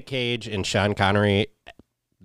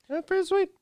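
falsetto; 4 dB quieter than usual. Pretend. Repeat in falsetto.

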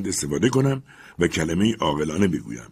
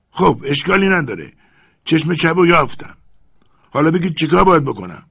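higher, louder call down; eager servant; 8 semitones higher, 8 dB louder than usual. Acted. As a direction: announce the find. Jupiter found it.

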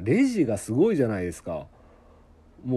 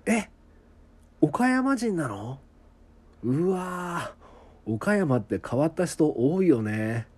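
high, slow drawn-out astonishment at hearing Okayama; mock amazed exclamation, stretching the うわー; a Tokyo acquaintance reacts to meeting someone from Okayama, drawn-out surprise; surprised Tokyo acquaintance; 5 semitones higher, much slower than usual. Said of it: "こう言われてたんですよ".

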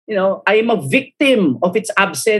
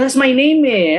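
mock indignant; 9 semitones higher than usual. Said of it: "Kasi parang".

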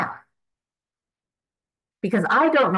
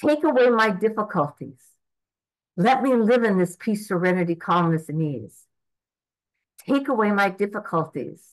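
earnest prayer; 3 semitones higher than usual. Acted. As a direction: ask gently for peace and ease. Prays aloud to God.

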